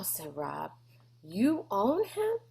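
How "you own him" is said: faked amazement. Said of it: "He tried to look excited and amazed".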